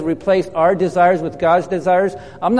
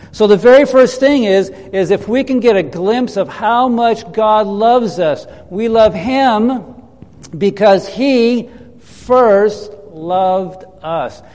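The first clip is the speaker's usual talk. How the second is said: ordinary delivery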